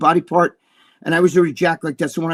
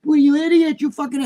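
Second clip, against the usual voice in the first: mock angry yell; 10 semitones higher, 3 dB louder than usual. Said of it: "Everybody's like".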